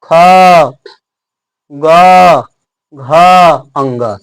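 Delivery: each letter spaced out and held; teacher slowly sounding out the throat letters; slow letter demo. Shown as a demonstration. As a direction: sound out "ख, ग, घ, अंग" one by one from the throat. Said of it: "बोल रहे हैं क".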